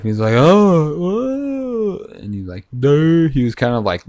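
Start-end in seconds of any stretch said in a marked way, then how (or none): 0.3-2.0 high, drawn-out wonder, rising upward; mock amazed awakening. Storyteller acting out Adam coming to life, mock amazed. 2.8-3.3 high, loud, wordless newborn daze; dazed new creature. Acted. Storyteller playing just-created Adam, loud and dazed.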